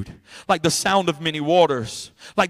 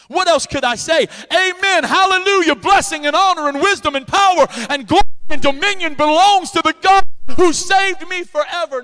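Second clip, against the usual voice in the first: much higher, louder shouts of hallelujah; ecstatic praise; 9 semitones higher, 5 dB louder than usual. Pretend. Preacher acting out his shout of praise in heaven. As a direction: let it ring out like the last day. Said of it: "you don't wanna hear me on that day".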